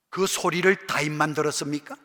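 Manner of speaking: normal talking voice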